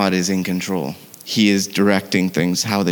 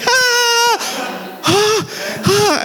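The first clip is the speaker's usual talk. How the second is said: high imitated wailing ahs; girl's stunned wails; much slower than usual. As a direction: mimic the girlfriend's high startled cries. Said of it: "And then the girl's like".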